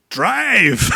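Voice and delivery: high, loud imitated yell; urgent shouted order. Shot passenger orders his driver onward, high urgent mock shout.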